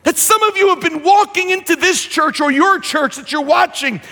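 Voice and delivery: preaching. Acted. Preacher builds on worship lost.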